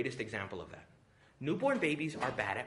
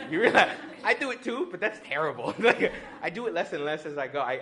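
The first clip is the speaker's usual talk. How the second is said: ordinary talk, nothing marked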